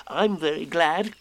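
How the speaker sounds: high, happy little voice; happy Piglet. A narrator's reading. Piglet echoing Pooh, happy small voice. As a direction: pipe it up gladly. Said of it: "said Piglet happily".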